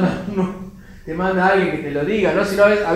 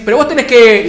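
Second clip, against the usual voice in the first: mock interrupted reply; 8 semitones higher, 13 dB louder than usual. God trying to answer.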